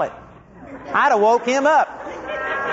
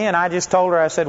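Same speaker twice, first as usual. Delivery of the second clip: ordinary talk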